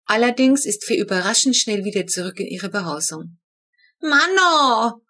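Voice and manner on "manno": put-on high, loud indignant child's yell; sudden outraged kid's shout. A narrator's reading. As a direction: shriek it out in disgust. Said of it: "brüllt Flo plötzlich".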